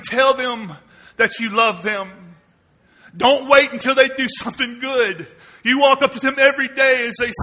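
ordinary talk

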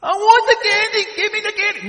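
put-on high, loud bratty kid whine; whining child. Preacher voicing a kid demanding candy, high loud whine.

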